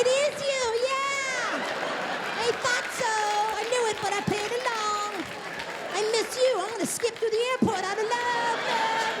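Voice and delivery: high, shrill-free sing-song fake excitement; mock gushing lover. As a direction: gush sarcastically like a giddy lover. Mock-gushing reply to wife.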